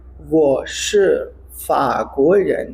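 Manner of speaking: 我是中国人 said very slowly, syllable by syllable; slow drill reading. Shown as a demonstration. Teacher reads Li Wen's line slowly for learners. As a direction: spell out 我是中国人 at a crawl. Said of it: "मेरे साथ बोलिए".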